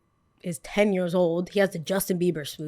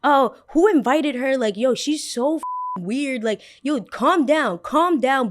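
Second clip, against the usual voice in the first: mocking friends; 5 semitones higher, slower than usual.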